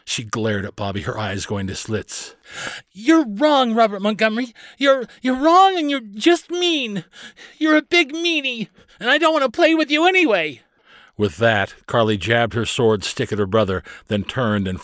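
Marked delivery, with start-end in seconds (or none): 2.9-10.6 put-on very high, loud child's tantrum; angry little girl. A narrator's reading. Carley glaring at her brother Bobby, furious outburst. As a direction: shriek it like a furious small sister.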